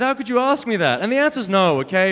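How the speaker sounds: ordinary talk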